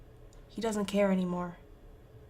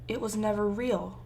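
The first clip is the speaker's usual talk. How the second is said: plain reading voice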